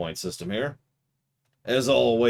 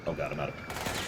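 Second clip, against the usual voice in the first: normal talking voice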